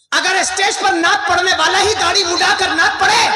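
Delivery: high, loud rhetorical challenge; full-force preaching. Acted. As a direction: thunder it out to the congregation.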